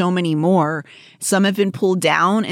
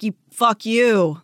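mock cruel prankster; 4 semitones higher, much slower than usual. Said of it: "going like".

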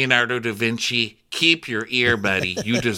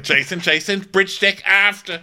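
high, loud radio bark; mock radio call; 10 semitones higher, 5 dB louder than usual. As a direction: shout it over the radio, hammed up. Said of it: "And he's like".

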